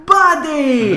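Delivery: high, loud drawn-out call; excited greeting. Real, not acted. Host hailing an old friend in chat, excited call. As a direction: sing it out to a pal. Calls out to viewer Cheer.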